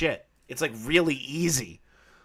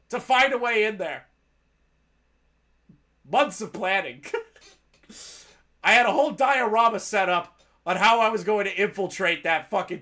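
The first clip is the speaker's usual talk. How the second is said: mock anger; 5 semitones higher than usual. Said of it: "I'm kidding".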